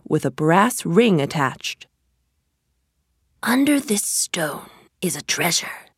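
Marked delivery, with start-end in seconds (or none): 3.4-5.9 secretive magician whisper. A narrator's reading. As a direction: breathe it like a secret. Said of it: "said the magician".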